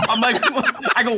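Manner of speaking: usual speaking voice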